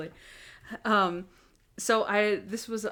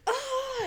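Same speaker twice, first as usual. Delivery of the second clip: retold outburst. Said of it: "and starts hitting him".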